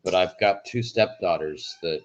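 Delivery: ordinary delivery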